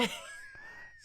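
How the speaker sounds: laugh-cry. Real, not acted.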